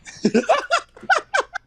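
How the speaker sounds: squealing falsetto giggle; high-pitched laugh. Real, not acted. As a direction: burst into a high shrieking laugh. Laughs after his own joke.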